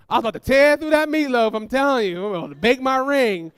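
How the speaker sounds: high, loud acted panic; frantic outburst. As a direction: exclaim like a frantic mother. Mother frantic over her ring.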